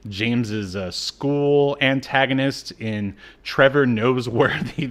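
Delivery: ordinary talk, nothing marked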